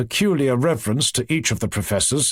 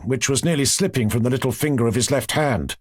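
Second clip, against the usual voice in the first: usual speaking voice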